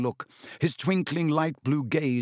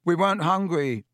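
put-on louder, raised boyish protest; loudly; 6 semitones higher, 4 dB louder than usual. A narrator's reading. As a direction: blurt it out loudly. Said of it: "said Ron loudly".

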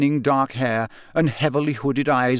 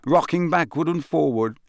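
ordinary delivery, nothing marked

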